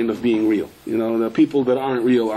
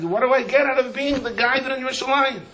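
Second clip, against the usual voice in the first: rav quoted in a raised voice; raised, mildly mocking quote of the rav; voicing the rav; 10 semitones higher than usual. The rav asks the askers what he gains, raised voice.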